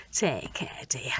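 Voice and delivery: whispered farewell; soft goodbye. Acted. Neighbour whispering goodbye on her way out, soft. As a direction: breathe it gently as you leave.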